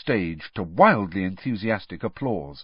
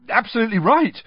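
higher, louder eager blurt; eager agreement; 13 semitones higher, 7 dB louder, faster than usual. Acted. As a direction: gush agreement quickly. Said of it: "he said quickly".

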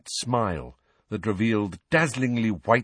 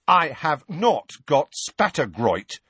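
slow, emphatic higher-pitched denial; indignant; 6 semitones higher, 3 dB louder, slower than usual. A narrator's reading. Boy denying the portrait's diagnosis, slow and indignant. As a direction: hammer out each word in protest. Ron denies the diagnosis angrily.

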